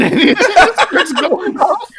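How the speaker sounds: high laughter through the words; giggling high and slow; laughing. Real, not acted. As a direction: laugh while saying it. Laughing through own joke.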